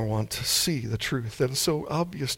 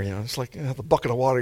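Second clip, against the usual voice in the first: normal talking voice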